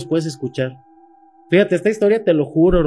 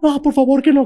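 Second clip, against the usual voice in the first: high, louder begging; desperate plea; 11 semitones higher, 4 dB louder than usual. Retold or imitated. Girlfriend begging him not to look under the bed, high desperate plea. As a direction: plead with him to stop, voice high. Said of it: "girlfriend pleading again".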